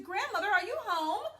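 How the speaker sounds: put-on high, loud little girl calling out. A narrator's reading. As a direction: call out brightly like a small girl at the door. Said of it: "and she said".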